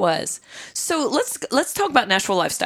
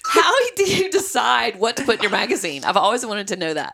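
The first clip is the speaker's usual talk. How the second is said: usual speaking voice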